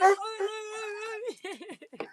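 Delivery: high, slow whiny dog voice; voicing the dog. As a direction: whine like a dog left out. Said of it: "He's like".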